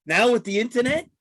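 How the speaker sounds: Sebastian Maniscalco. Retold or imitated. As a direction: imitate a comedian winding up a bit. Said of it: "Sebastian Maniscalco does that".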